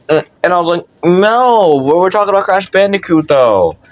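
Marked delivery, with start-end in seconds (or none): 1.0-3.8 mock childish protest, dropping at the end; whiny protest. Kid arguing with the music teacher, protesting.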